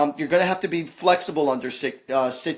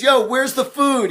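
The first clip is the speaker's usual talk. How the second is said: excited; 11 semitones higher, 8 dB louder than usual. Retold or imitated. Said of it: "He says".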